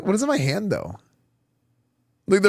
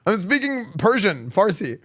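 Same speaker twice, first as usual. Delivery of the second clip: normal talking voice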